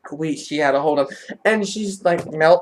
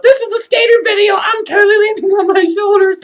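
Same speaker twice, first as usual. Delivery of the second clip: high, loud, fast mock panic; racing thoughts aloud; 7 dB louder, faster than usual. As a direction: blurt frantic thoughts mid-flip. Voicing racing thoughts mid-flip.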